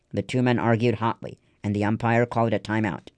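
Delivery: plain reading voice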